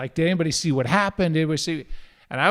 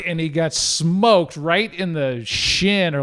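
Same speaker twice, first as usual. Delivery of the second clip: ordinary talk, nothing marked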